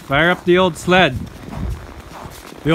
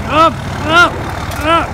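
shouting up; 11 semitones higher, slower than usual. Real, not acted. Urging sled up the hole.

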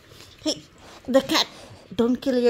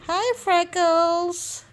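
higher, slow, drawn-out sing-song hello to the dog; cooing greeting; 8 semitones higher, slower than usual. Real, not acted. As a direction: stretch each word like greeting a puppy.

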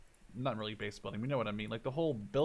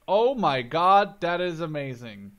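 louder, slow, thrilled exclaim; amazed delight; 3 semitones higher, 10 dB louder, slower than usual. Real, not acted. Gamer seeing the construction menu, loud amazed delight. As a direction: let the wonder burst out.

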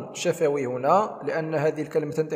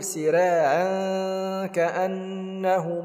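slow, chanted tajweed recitation; measured tarteel chant; Quran recitation; 4 semitones higher, much slower than usual. Shown as a demonstration. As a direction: recite it melodically, drawing out the madd. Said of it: "نقول".

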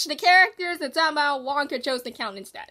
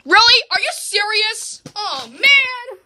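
high, loud, indignant outburst; loud protest; 6 semitones higher, 11 dB louder, slower than usual. Real, not acted. Player reacting to a loss, loud high protest. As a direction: protest loudly at the unfair result.

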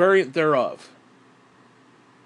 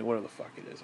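normal talking voice

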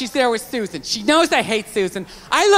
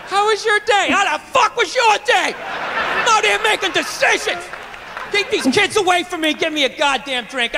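hammed-up, high-pitched marital rant; angry venting; 6 semitones higher than usual. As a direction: play an exasperated husband blowing off steam. Acting out dad venting at his wife.

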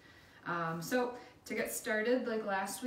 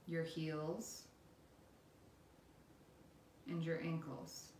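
guided meditation; 5 dB quieter than usual. Acted. Instructor leading listeners through a body scan, calm guided meditation.

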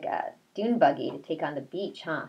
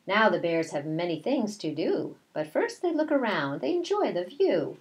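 slow, sing-song read-aloud; reading to children; 3 semitones higher than usual. A narrator's reading. Teacher reading a picture book to her class, slow sing-song. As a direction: read the rhyme slowly to the class.

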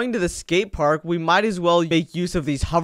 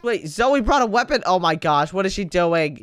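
ordinary delivery

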